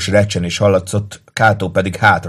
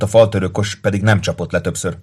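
ordinary delivery, nothing marked

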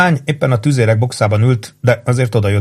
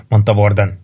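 usual speaking voice

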